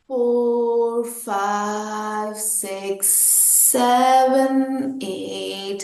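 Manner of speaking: slow, drawn-out counting; sustained count that softens at the end; exhale count. Shown as a demonstration. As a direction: stretch the numbers to pace a long breath out.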